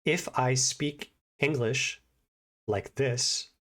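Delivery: slow English with every sound enunciated; spaced-out learner-friendly speech, word by word; slow, over-enunciated. Shown as a demonstration. Showing how to talk to learners, slow and enunciated. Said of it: "to give you an example".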